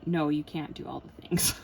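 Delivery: loud, mock-stern contradiction; voice of depression. Depression answers her pep talk, scolding loudly. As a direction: shut the hope down firmly, playfully acted. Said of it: "my depression kicks in".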